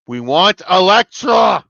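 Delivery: loud, drawn-out chant-like demand; rallying shout. Real, not acted. Fan demanding a favourite character back, rallying shout.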